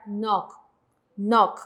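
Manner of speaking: "nok" ending on a k, no s sound; pronunciación modelo. Shown as a demonstration. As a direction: demonstrate "nok" slowly, closed by k alone. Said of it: "se pronuncia la kyok, la shiot se omite". (pronunciar "nok" con k final, sin la s)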